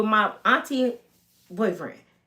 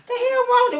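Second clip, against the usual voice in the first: high mock neighbor; 13 semitones higher than usual. Neighbors voiced.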